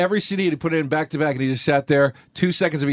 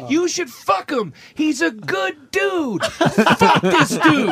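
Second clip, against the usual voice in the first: high, slow, drawn-out holler; mock-bellowed endorsement of a pal; imitated insistent yelling; 9 semitones higher, much slower than usual. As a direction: hammer each word out, pitched up. Retold friend loudly pushing the date.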